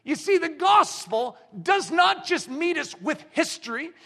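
impassioned sermon cry; full-force preaching. Acted. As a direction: drive it home to the crowd.

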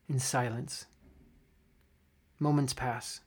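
soft, hushed storyteller's narration; story narration. A narrator's reading. Game master describes the gnome's last breath, quiet and grave. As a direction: let the silence after a death settle.

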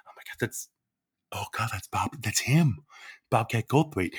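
whispered, then softly voiced awe; starstruck whisper. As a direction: hiss it so he can't hear. Starstruck whisper passing Goldthwait.

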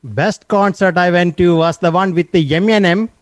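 put-on Indian accent; lively Indian accent, persona voice. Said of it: "be hard to maintain the accent the whole time".

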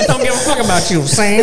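put-on high laughing old-movie mobster; 1940s gangster voice. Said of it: "He's a nineteen forties gangster".

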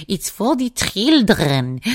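slow, drawn-out cartoon teacher voice; Madame Gazelle. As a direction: stretch it out like a caricatured nursery teacher. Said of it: "like Madame Gazelle. She's like".

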